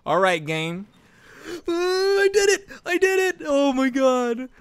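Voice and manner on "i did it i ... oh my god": very high, thrilled cry; high-pitched victory yelp; triumphant squeal. Real, not acted. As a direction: squeal with disbelief at finally winning. Succeeds after many failed tries.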